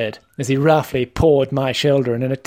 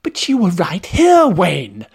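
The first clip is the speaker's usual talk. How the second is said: higher, indignant plea for leniency; pleading; 5 semitones higher than usual. Acted. Learner begging the trainer for mitigation, pleading. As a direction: protest and plead your case. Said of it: "I pleaded".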